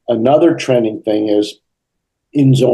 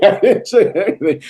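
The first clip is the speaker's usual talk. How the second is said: laughing denial; 10 semitones higher than usual. Retold or imitated.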